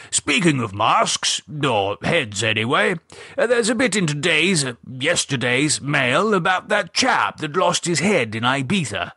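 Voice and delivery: breezy, gossipy retired gentleman; Gerald's voice. Acted. Gerald opening his newspaper to his wife, chatty old gent voice.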